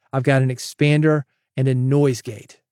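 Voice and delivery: usual speaking voice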